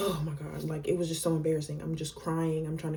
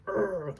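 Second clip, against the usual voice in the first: imitated frustrated growl; angry growl; 2 semitones higher than usual. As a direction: growl like a fed-up man. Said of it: "he was like".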